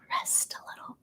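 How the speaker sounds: soft breathy whisper of rest a little. Acted. Lulling her audience toward rest, whispered. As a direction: hush it right down, almost no voice. Soothing talk about rest.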